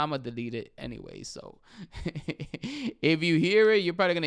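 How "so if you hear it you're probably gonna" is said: laughing. Real, not acted. Laughing while joking about deleting.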